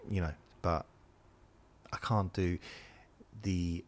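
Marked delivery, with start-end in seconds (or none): none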